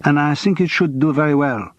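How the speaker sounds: put-on heavy French accent, cool and clipped by resentment. A narrator's reading. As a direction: speak English as a curt Frenchwoman with a heavy accent. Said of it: "Her English was heavily accented".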